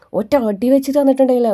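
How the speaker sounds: half-joking scolding to Aleena; mock threat. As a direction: raise the hand and scold her. [name] threatens a slap.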